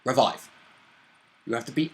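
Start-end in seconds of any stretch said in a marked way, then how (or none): none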